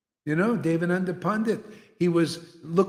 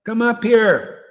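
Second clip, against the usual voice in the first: loud, slow, higher-pitched summons; calling out; 5 semitones higher, 7 dB louder, slower than usual. Pretend. Preacher pretends to summon rival guru, loud slow call. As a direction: call the challenger up front, drawn out.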